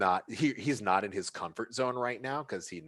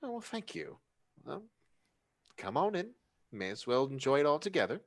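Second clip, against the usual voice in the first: elegant hostess voice; 7 semitones higher than usual. Acted.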